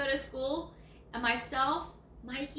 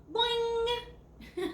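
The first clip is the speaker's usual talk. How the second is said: much higher cartoon boing; boing sound; 10 semitones higher than usual. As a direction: bounce the word up like a spring. Showing Susie jumping.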